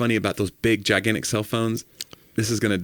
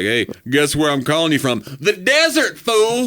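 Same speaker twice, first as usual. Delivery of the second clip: higher and louder, gloating on the phone; mock bragging shout; 6 semitones higher, 4 dB louder than usual. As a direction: rub it in to your rival, yelling. Caller brags on first cell phone.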